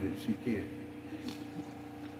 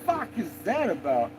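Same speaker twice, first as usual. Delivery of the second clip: higher, disbelieving retold question; mock baffled exclamation; 10 semitones higher than usual. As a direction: replay your disbelief at the dog. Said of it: "I'm like".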